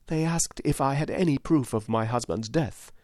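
a loyal widow praising her lord; humble, heartfelt widow; earnest widow. A narrator's reading. Voiced widow in the story.

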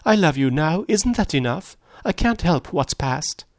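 high, distressed woman's plea; near-tearful pleading. A narrator's reading. Woman pleads with her lover over the past, close to sobbing. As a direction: plead high, slowing at the end. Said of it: "She began to sob helplessly".

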